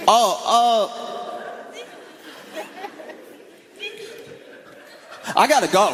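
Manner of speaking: high, flustered friend; high and awkward, hurrying off the call. Retold or imitated. Friend hastily hanging up, high and flustered.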